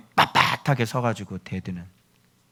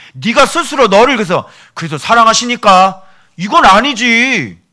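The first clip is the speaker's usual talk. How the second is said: louder, higher, pounding each phrase from the pulpit; full-force preaching; 9 semitones higher, 11 dB louder than usual. Acted. Pastor scolding the congregation about pride, loud forceful preaching.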